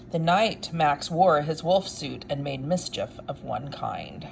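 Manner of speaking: lively read-aloud storytelling voice; story narration. A narrator's reading. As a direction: read it like a bedtime picture book. Reads picture book aloud.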